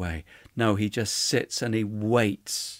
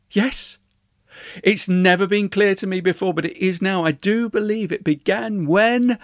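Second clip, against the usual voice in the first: raised, louder voice of sudden realisation; higher, insistent, discovering it mid-speech; eager revelation; 6 semitones higher, 6 dB louder than usual. A narrator's reading. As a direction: build with excitement as it dawns on you. Retold outburst of Lady Susan's revelation.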